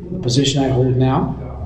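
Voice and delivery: ordinary talk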